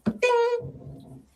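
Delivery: high drawn-out chime; elevator ding. Retold or imitated. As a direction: sing the bell high.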